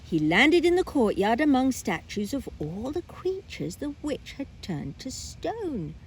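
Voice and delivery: usual speaking voice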